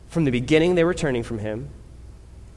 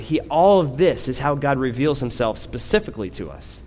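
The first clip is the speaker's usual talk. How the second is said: ordinary talk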